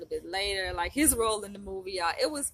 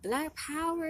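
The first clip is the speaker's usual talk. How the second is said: retold rallying shout; 10 semitones higher, slower than usual. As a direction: imitate cheering them on, pitched way up.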